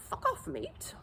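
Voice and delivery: high, soft British accent, rising at the end. Shown as a demonstration. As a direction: say it lightly in a high put-on British voice. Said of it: "in a British accent".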